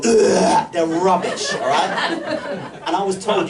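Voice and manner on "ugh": short loud penguin grunt. Retold or imitated. Comedian imitating a real African penguin's grunt, loud. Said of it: "these things just go".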